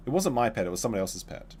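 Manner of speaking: ordinary talk, nothing marked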